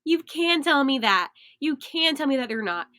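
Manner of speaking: normal talking voice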